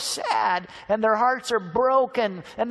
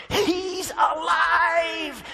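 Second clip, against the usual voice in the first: ecstatic shout; 9 semitones higher, much slower than usual. Acted. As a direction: stretch out alive in triumph. Disciples realize resurrection.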